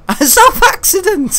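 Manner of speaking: high, loud, drawn-out laughing echo; laughing at own slip. Real, not acted. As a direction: crack up while repeating your own blunder.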